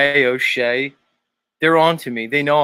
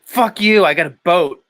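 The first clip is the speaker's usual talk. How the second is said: louder, higher attempt at a New York accent; [name]; 5 semitones higher, 5 dB louder than usual. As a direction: brush him off in a mock New York accent. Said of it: "trying to get the accent down".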